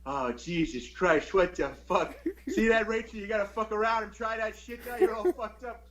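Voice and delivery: a lightly voiced grumbling old man; imitating grandfather. Grandson imitates grandfather scolding grandma, lightly exasperated. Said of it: "he's like".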